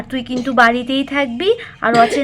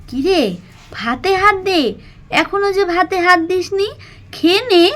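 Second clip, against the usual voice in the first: lightly voiced mother urging, a little higher; coaxing mother; 6 semitones higher than usual. Mala, to Pakhi, coaxing her to eat. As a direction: nudge the child to start eating.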